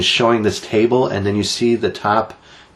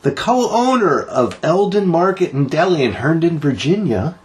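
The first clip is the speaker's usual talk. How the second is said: bright, high news-anchor voice; news read; 7 semitones higher than usual. Acted. Host announcing a local story to listeners, high announcer voice.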